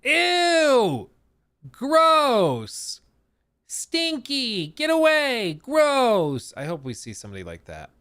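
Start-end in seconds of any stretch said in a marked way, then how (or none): 0.0-6.5 high, loud cries of disgust; disgusted townsperson shouting. Retold or imitated. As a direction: yell at the freak to get away. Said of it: "they see that character's face".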